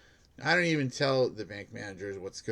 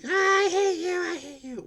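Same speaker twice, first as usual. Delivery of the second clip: high, shrill-pitched mock yell of the woman; 7 dB louder than usual. Security guard mimics homeless woman yelling at a text, high mock yell. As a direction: imitate her yelling into her phone. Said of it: "she started yelling".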